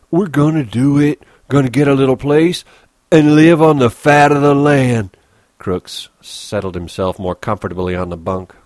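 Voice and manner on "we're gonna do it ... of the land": higher, louder eager insisting; Lenny promising the little place; excited Lenny. Acted. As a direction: insist with childlike hope.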